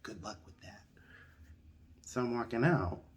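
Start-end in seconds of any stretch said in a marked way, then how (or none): 0.0-0.8 quiet aside. Retold or imitated. Clerk dismissive aside.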